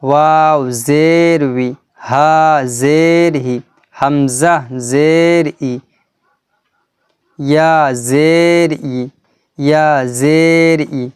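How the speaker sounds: slow spelling chant, জের held and last syllable short; stretched letter names, brief final vowels; model recitation. Shown as a demonstration. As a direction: demonstrate ওয়াও জের উই measuredly, with a short উই. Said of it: "তো এটি এভাবে পড়বেন".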